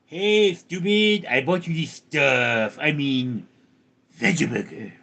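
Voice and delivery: a character correcting the speaker, voice put on; butting-in character voice. Character scolding over what was bought for the fridge, put-on voice.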